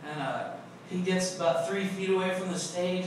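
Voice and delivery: usual speaking voice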